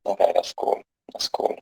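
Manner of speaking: dropping to a near whisper; said under the breath; quiet aside. Real, not acted. Quiet aside after answer.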